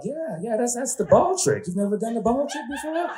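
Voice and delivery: cousins voiced high and eager; high, eager cousin voices; high-pitched cousins. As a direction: chime in like excited cousins.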